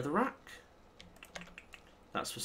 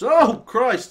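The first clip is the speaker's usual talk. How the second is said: loud, high startled cry; startled yelp; 9 semitones higher, 12 dB louder than usual. Real, not acted. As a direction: jump at the sudden creeper. Creeper appears out of nowhere.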